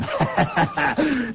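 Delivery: taunting laugh. Acted. Villain laughs at McFarlane's expense.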